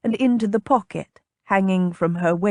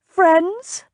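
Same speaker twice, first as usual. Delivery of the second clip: high-pitched, pointed one-word question; pointed query; 10 semitones higher, 4 dB louder than usual. A narrator's reading. Lady interrupting the captain about friendship, pointed. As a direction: cut in with a higher questioning voice.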